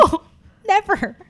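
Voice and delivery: retold firm no, pitched high; high, mock-appalled rejection with a pause; emphatic refusal. Woman refusing to see her date again, emphatically.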